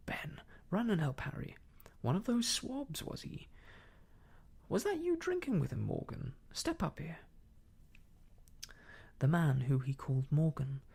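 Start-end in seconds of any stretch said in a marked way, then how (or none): 0.1-7.2 indignant innkeeper, high-pitched outburst; an affronted landlord taking charge; Silver crying out. A narrator's reading. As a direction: exclaim in outrage, then give orders. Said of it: "cried Silver".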